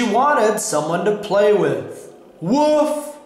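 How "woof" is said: high imitated dog woof; dog's woof. A narrator's reading. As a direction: voice a pet dog's bark, pitched up. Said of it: "said Ebb".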